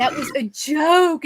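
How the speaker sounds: drawn out with a laugh in it; a laughing that was a joke. Retold or imitated. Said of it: "and I'm like".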